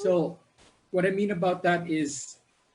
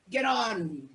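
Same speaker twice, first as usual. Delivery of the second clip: mock shout, drawn out and higher; imitated captain's shout; 6 semitones higher than usual. Captain to everybody at the boat, imitated shout. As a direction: call it out like a captain, without real volume. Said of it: "he would shout to everybody".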